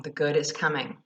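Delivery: usual speaking voice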